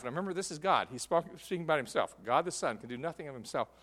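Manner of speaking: usual speaking voice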